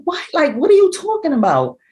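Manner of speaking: high, alarmed what are you talking about; shocked disbelief. Retold or imitated. Mother on the phone hearing shocking news, high disbelief. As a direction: react to bad news on the phone, stunned.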